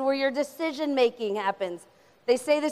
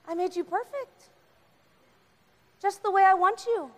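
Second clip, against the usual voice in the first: tender God; 7 semitones higher than usual. Acted.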